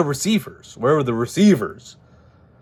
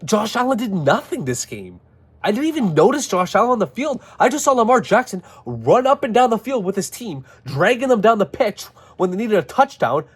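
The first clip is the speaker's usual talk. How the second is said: heated rant; 6 semitones higher than usual. Real, not acted. Fan ranting about the playoff loss.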